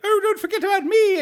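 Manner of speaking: very high, squeaky little character voice; Billy Barty. Retold or imitated. Co-host voicing a character jumping up to be included, high squeaky impression. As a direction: pipe up in a tiny cartoon squeal. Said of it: "the actual voice of Figment, Billy Barty".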